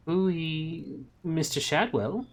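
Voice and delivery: high, sing-song Ooh; friendly landlady. A narrator's reading. Landlady greeting Shadwell from the corner, light high coo. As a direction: coo it to the lodger. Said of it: "giving Newt a friendly little wave".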